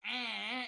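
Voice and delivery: drawn-out "eh" noise, slightly high; imitated crowd response. Everyone in the club answering the call, droning "eh".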